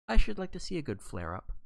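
a touch of mischievous eagerness; eager. Acted. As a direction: savour the idea of a fight. Mary wishing for a quarrel.

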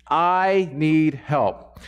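slow, earnest plea for help; drawn-out humble plea; slow pleading prayer. Retold or imitated. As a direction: plead slowly to God. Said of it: "So you go".

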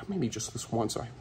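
usual speaking voice

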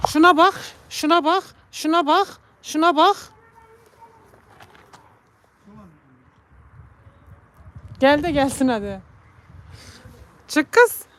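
high, loud urging calls; coaxing the cat. Real, not acted. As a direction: coax the cat out of the coal shed. (kediyi dışarı çağırırken yüksek sesle)

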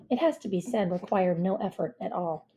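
usual speaking voice

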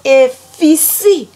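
high, slow Liberian exclamation; Liberian accent. Shown as a demonstration. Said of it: "how Liberians say things".

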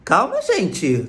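put-on drawn-out, whiny swooping kid voice; child's voice. Child online gaming calming a panicked household, mock child voice. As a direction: stretch it slowly like an unbothered kid. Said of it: "a criança falou".

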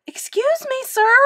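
put-on very high little boy voice; small high-pitched polite interjection; timid boy. A narrator's reading. Boy interrupts bellowing coach.

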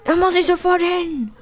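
high eager squeal; bursting to get there; voiced for a sim character, high and eager; excited. Gamer voicing excited sim.

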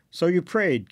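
interrupt, tired of hearing it; mild impatient scoff. A narrator's reading. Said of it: "Ken interrupted impatiently".

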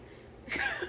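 short high-pitched laugh. Real, not acted. Laugh after Tiffany quote.

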